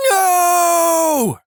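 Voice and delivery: long drawn-out scream, pitched far up; deep-throated scream. A narrator's reading. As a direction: let out one sustained, wailing shout. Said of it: "a deep-throated scream".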